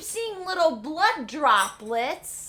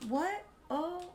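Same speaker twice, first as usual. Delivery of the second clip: ordinary talk, nothing marked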